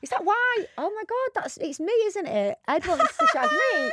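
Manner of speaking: high, slow mock gasp of discovery; mock shocked realisation. Teasing her co-host, a high slow mock realisation. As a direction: play the horrified penny dropping.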